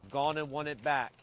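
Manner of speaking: normal talking voice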